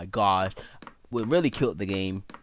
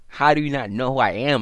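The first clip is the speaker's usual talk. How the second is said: ordinary delivery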